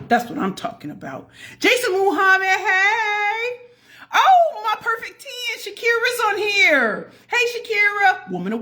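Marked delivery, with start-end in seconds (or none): 1.6-8.3 high, slow, delighted calls to each name; excited greeting. Real, not acted. Greeting more viewers by name.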